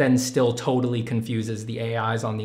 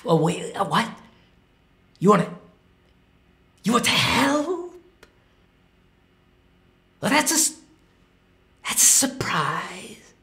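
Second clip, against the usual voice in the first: timid math character; 11 semitones higher than usual. Pretend. Math, now a nervous character, invites the artist in for tea. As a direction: play shy math as a nervous little host.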